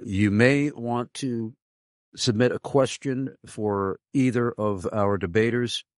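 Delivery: ordinary delivery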